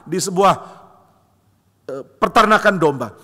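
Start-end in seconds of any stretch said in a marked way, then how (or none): none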